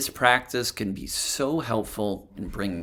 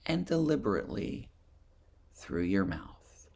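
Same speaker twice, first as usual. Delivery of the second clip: guided meditation; 3 dB quieter than usual. Acted. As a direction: gently lead them through a body scan, phrase by phrase. Leads a breathing and body scan meditation.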